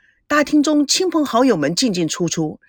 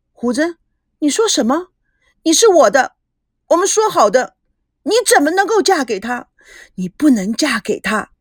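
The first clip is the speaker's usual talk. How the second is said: upset outburst; 5 semitones higher than usual. A narrator's reading. Jealous lover confronts the bride.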